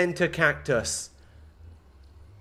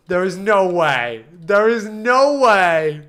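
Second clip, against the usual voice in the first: slow, loud, rising to a drawn-out cry; mounting disbelief; 7 dB louder, slower than usual. Real, not acted. Streamer incredulous at the build, building to a shout. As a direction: stretch it out louder the second time.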